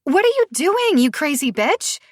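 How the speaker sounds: high, loud furious yell. Acted. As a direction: yell while shoving the door. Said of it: "Sophie yells".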